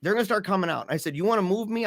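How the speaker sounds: high, insistent retold warning; acted defiance. Acted standoff with hospital staff.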